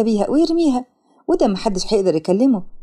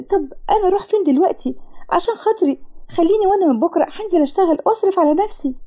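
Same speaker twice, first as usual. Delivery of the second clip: put-on high pleading girl voice; begging daughter; 6 semitones higher than usual. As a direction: beg him in a higher, helpless voice. Daughter begs father not to evict. (بنت بتتوسل لأبوها)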